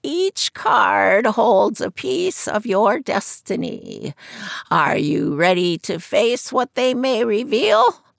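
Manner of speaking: put-on high croaky old seer's voice; croaking fortune teller. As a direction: croak it from behind a curtain. Madame Zora reading cards.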